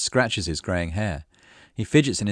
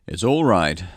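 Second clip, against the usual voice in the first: louder, brisk reassurance; quick cut-in; 5 dB louder, slower than usual. A narrator's reading. Cyril interrupts the villager's apology, quick and firm. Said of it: "Cyril says quickly, cutting him off".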